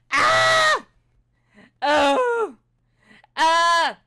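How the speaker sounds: high piercing screams; test screams. Shown as a demonstration. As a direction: scream for the mic level test. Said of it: "So we're gonna be like".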